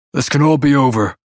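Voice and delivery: a slight quiver in the voice; pleading male voice. Acted. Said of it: "His voice is deep, slight quiver".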